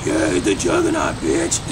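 very low, rough Juggernaut imitation. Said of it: "the cast who came up with".

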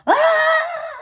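high wailing cry, loud and sudden; imitated crying wail. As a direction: mimic her sobbing scream. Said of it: "this girl was crying".

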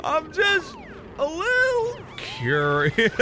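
high, slow, coy voice; mock-innocent high curiosity; giddy mischief. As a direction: squeak it out like a naughty confession. Giddy about opening the airlock.

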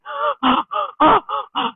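high, acted gasps; panting. A narrator's reading. Feku flees the tiger to the village, high panting. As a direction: pant as if running for your life. Acts out running really fast.

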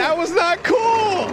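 high, slow protest; indignant shout. Real, not acted. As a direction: call the foul, stretching it high. Reacting to bad spin.

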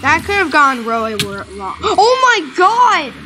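ordinary delivery

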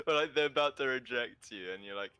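ordinary delivery

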